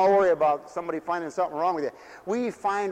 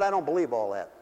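ordinary talk, nothing marked

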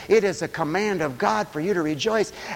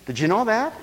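usual speaking voice

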